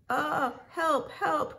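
high, acted cry for help. A narrator's reading. Trapped car looks for a way out, crying for help. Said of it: "cried Lightning".

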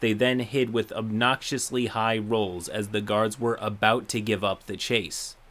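usual speaking voice

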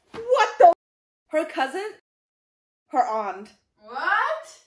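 high, loud stunned exclaims; shocked outburst. Real, not acted. Reader reacting to a shocking family reveal, shocked outbursts. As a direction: gasp out each reveal in disbelief.

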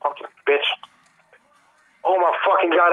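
ordinary delivery

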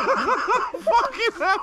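high, slow, drawn-out pained groan; wailing through real finger pain. Real, not acted. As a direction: let the pain stretch the words out.

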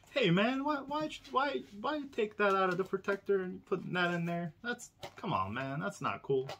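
a hint of a friend's mock complaint; Hewitt impression. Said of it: "I was trying to do my best Hewitt, uh, impression".

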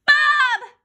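very high, very loud blurt; excited shout. A narrator's reading. Boy racing to answer a quiz, excited shout. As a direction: bellow the name with total confidence. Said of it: "I shouted".

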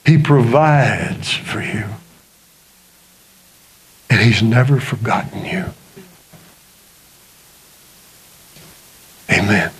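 slow, intense sermon building to a hushed close; earnest preacher lowering to a hush; preaching. Acted. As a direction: preach it, then drop to a whisper. Preaching to congregation.

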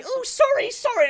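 high prissy mocking voice; mocking the opponent's fake apology. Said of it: "you can't say".

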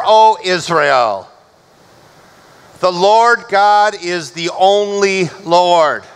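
ordinary talk

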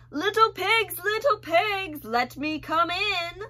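loud, high, slow bellow; bellowing wolf. Acted. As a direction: call out to the pigs like a hungry wolf. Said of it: "bellowed the wolf".

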